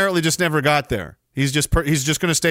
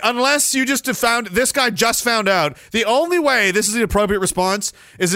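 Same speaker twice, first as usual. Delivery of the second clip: ordinary talk, nothing marked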